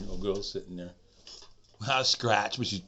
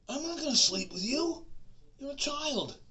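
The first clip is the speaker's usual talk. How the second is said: indignant high refusal. Retold or imitated.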